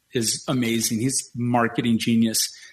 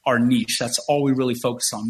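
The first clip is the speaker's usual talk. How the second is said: usual speaking voice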